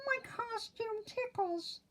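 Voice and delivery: high, soft, tickled pig voice; giggly pig. Acted. Oinky in itchy costume, high and giggly. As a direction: talk through the tickles, high. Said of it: "giggled Oinky".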